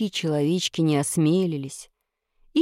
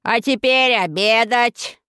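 slow, loud imperious order; commanding shout; 5 semitones higher, 4 dB louder than usual. A narrator's reading. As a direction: demand dinner like a giantess. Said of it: "приказала она".

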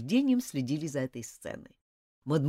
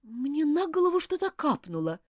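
surprised; 7 semitones higher than usual. A narrator's reading. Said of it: "удивлённо сказала она".

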